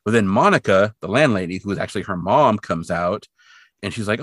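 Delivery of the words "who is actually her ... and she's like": usual speaking voice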